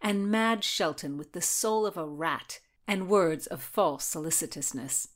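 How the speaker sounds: usual speaking voice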